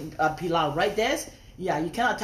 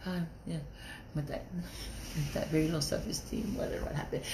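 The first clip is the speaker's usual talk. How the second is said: much softer, mumbled hint of a hesitant brother; imitating brother; 5 semitones lower, 10 dB quieter than usual. Retelling what her brother told her, soft mumbling imitation. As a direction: mumble it low like the brother unsure. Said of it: "my brother goes".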